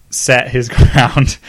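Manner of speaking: ordinary delivery, nothing marked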